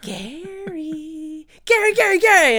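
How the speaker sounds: hushed at first, then climbing to a high, loud, laughing yell; mad Gary clones. Retold or imitated. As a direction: start as a creepy murmur and build into a crazed shout of the one name. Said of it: "And they're like".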